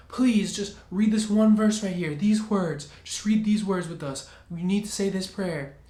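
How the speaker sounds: desperate wife pleading loudly; begging woman. Retold or imitated. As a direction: beg him frantically in her voice. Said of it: "she started begging me".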